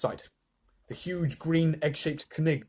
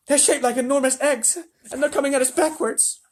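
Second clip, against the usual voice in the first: higher, loud radio shout; shouting astronaut; 12 semitones higher, 5 dB louder than usual. A narrator's reading. Shanks shouts to the president, higher and loud. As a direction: yell the report. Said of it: "Shouted Shanks".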